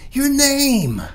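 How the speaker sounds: high, loud call down the hall. Acted. Mother calls after her son down the hall, loud and high. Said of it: "he hears his mama call".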